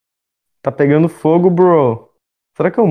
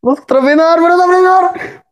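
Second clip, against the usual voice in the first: panicked shout; 13 semitones higher than usual. Real, not acted. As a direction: yell in panic. Stuck in a tree, panic.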